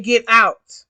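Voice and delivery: loud retold order to get out; retold shout. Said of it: "I said".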